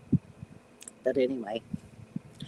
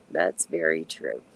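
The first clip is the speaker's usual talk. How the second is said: much lower and slower, a mock-deep echo; 14 semitones lower, 6 dB quieter than usual. Shown as a demonstration. Echoing a viewer's point to the stream in a deep, slow mock voice. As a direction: drop the voice way down and drag it out. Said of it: "repeats viewer comment in two voices".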